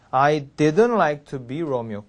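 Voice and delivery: "didn't" stressed, its T silent. Shown as a demonstration. 선생님 시범, "didn't"에 강세. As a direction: lean on "didn't", swallow the T. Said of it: "didn't 여기에 강을 줍니다".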